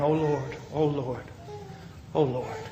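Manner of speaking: soft, falling repeated plea; retold anxious prayer. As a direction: pray it softly under pressure. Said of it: "And I'm praying".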